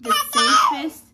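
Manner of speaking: real tears cutting into the words; voice breaking. Real, not acted. Recalling a scary moment in labour.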